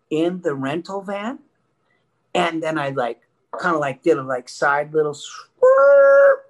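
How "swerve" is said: high, loud drawn-out screech; vocal sound effect of a skidding van; imitated tire screech. Driver jumps the curb, loud imitated swerve screech.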